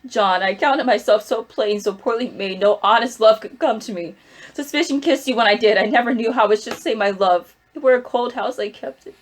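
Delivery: loud, anguished confession poured out to her husband; tearful plea. Acted. Wife pleading with condemned husband, loud and anguished. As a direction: break open, begging him to understand.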